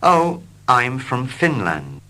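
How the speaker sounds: ordinary talk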